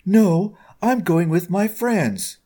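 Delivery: high, drawn-out defiant refusal; defiant child. A narrator's reading. Daughter refuses to leave her friends, high and defiant.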